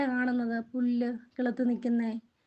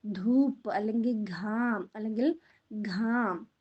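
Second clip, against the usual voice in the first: slow teaching read; slower than usual. Shown as a demonstration. Teacher to pupils, saying the Hindi words slowly. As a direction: slowly spell out ധൂപ്പ് and ഘാം for the class. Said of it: "എന്താണ്?".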